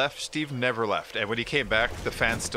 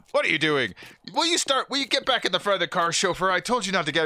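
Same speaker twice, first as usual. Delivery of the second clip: higher, louder mock boss ordering the chauffeur; a rich man scolding his chauffeur, higher and louder; bossy rich guy; 7 semitones higher, 3 dB louder than usual. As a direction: bark orders at the help, hammed-up. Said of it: "He's like".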